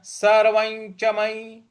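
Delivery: सर्वं च मयि intoned, सर्वं gliding into "nycha"; slow level chant, ञ linking सर्वं to च; palatal nasal before च. Shown as a demonstration. Teacher modelling सर्वं च मयि, slow nasal link. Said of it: "इस प्रकार से बोलेंगे".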